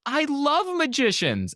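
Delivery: very high, slow, gushing imitation; amped up. Woman on plane hearing he's a magician, imitated amped-up excitement. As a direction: imitate a thrilled fan, drawn out and high. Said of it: "She was so amped up about it".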